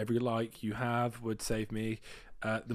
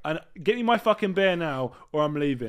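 rude demand; 5 semitones higher, 8 dB louder than usual. Acted. Rude customer demanding beer.

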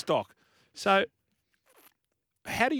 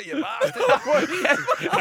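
laughing mock yell; 5 dB louder, much slower than usual. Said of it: "probably ran out and said".

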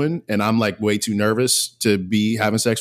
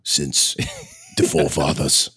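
put-on old Scottish sage; 8 semitones lower than usual. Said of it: "sage advice".

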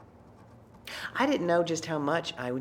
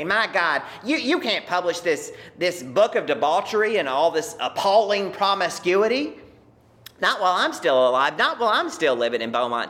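mock-indignant father, louder and higher; imagined outraged father; 4 semitones higher, 7 dB louder than usual. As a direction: ham up the scandalized old man forbidding it. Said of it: "I could just hear him say".

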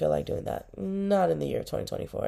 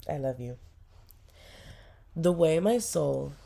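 ordinary delivery

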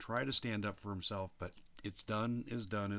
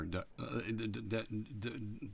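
stuck stutter on d; real stammer on the first sound; slower than usual. Real, not acted. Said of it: "'cause I'm stuttering over my words here".

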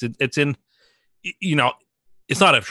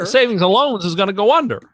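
louder, a bit higher, pleading; desperate Jimmy Stewart; 6 semitones higher, 4 dB louder than usual. Pretend. Keeping up the impression, a lightly desperate Stewart. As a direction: sell the panic of a failing bank. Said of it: "You don't sound desperate".

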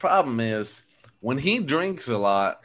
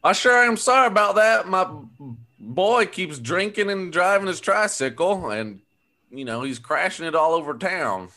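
apologetic neighbor; 7 semitones higher, 3 dB louder than usual. Acted. Folksy walker role.

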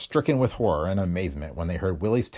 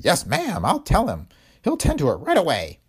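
lightly voiced old Willie; 8 semitones higher, 3 dB louder than usual. A narrator's reading. Willie on the phone.

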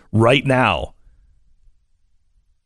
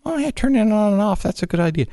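higher, mocking fake agreement; sarcastic mock quote; 9 semitones higher than usual. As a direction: parrot the advice with scorn. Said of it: "just take that one and just say".

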